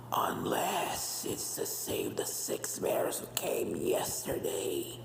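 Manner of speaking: very slow eerie whisper trailing down; ghostly whisper. Acted. Pink mare's portrait taunts the ponies, slow eerie whisper. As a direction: breathe it out like a haunted painting. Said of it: "a voice coming from the portrait".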